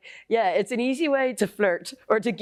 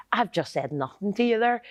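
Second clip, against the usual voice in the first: usual speaking voice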